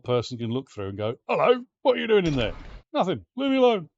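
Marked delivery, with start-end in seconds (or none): 1.3-2.5 high, loud, quick nosy passerby; intrometido chamando. Acted. Passerby calls through the window. 2.9-3.9 high, loud acted protest; protesto encenado. Caught person protests to peeping passerby.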